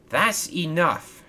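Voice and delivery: slow, high-pitched firm shout; angry shout. A narrator's reading. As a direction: draw it out to put a stop to things. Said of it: "shouted Victor".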